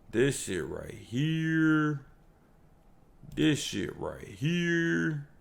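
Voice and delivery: slow, stunned excitement; savoring hype. Real, not acted. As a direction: relish every word like a treat. Hyped reaction to trailer news.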